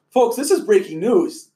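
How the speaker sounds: hammed-up breaking news alert; mock news announcement. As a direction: announce it like a TV anchor, playful.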